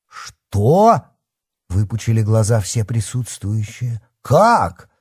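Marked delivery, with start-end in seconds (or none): 0.1-1.1 high shocked gasp of a question. Acted. Everyone shocked by the news. 4.2-4.9 high, loud disbelieving question; shocked. Acted. Everyone shocked by the news.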